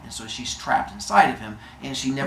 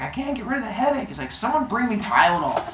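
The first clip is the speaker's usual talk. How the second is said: whiny demand; 8 semitones higher, faster than usual. Retold or imitated. Zeus complaining to gods.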